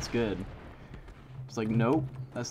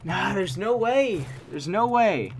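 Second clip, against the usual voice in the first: higher, slow, loud no way; disbelief; 8 semitones higher, 4 dB louder, slower than usual. Real, not acted. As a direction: drag out the disbelief. Disbelief at missing.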